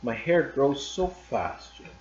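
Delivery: slow and tender, through tears held back; a lightly voiced wife, slow and gentle; slow brave reassurance. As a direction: say it slowly to comfort him. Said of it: "able to look up and say".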